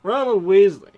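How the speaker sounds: put-on radio-host name read; smooth radio voice. Shown as a demonstration. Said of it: "like a smooth radio voice".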